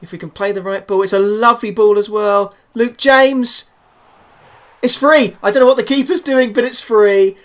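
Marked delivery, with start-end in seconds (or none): 1.0-7.4 loud, high goal call tipping into amusement; excited disbelief. Real, not acted. Commentator sees a third goal go past the keeper, excited disbelief. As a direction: build to a delighted shout.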